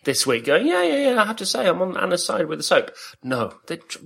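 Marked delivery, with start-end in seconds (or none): 0.7-2.9 mock imitation. Imitating Rachel siding against him.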